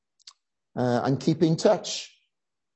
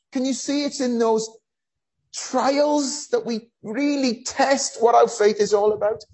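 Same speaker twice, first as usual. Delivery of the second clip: impassioned preaching; 8 semitones higher than usual. Acted. Preaching the struggle of faith.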